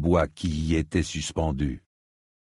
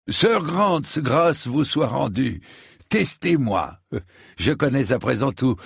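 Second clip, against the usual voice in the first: haughty Sir Stephen; 6 semitones higher than usual. A narrator's reading. Sir Stephen challenges Sir Grant while mocking Dunk, high and arrogant. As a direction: show off and dismiss Dunk in passing.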